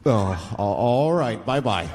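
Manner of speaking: low, hesitant businessman sign-off; thrown businessman. Acted. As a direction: stumble into an awkward, low goodbye.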